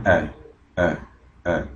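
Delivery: article "a" said as a short "E"; short E sound. Shown as a demonstration. Teacher showing how article "a" sounds, short "E". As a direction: say "E" as a separate short vowel, repeated. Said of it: "Se pronuncia".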